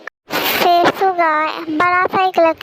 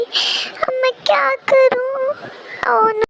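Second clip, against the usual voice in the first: despairing; 6 semitones higher, much slower than usual. Acted. Despairs at the swapped life.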